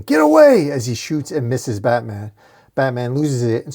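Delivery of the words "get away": high, loud desperate scream; piercingly high acted shout. A narrator's reading. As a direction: scream to drive off an attacker. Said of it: "D J screaming".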